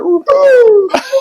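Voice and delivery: helpless high-pitched laugh; squeaky disbelieving laugh; high breathless laugh. Real, not acted. Laughing at the craziness.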